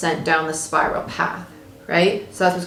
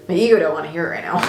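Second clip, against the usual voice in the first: ordinary talk, nothing marked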